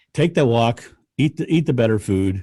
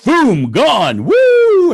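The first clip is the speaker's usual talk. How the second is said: high, loud burst of joy; excited whoop; 9 dB louder, much slower than usual. Retold or imitated. Erasing the whiteboard, a loud high excited whoop. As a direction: cheer as the board wipes clean. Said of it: "I get really excited about it".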